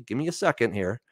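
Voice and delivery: ordinary delivery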